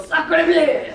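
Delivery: loud mock French outburst; hammed-up French cry; French exclamation. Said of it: "He's French. You know how they are".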